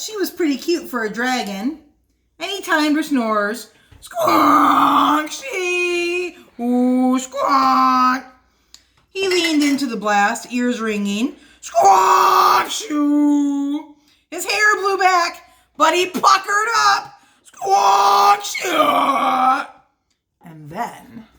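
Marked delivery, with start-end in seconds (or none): 4.0-8.2 大きないびき; loud snoring. Acted. Dragon snoring blast. 11.6-13.9 大きないびき; loud snoring. Acted. Dragon snoring blast. 17.4-19.7 大きないびき; loud snoring. Acted. Dragon snoring blast.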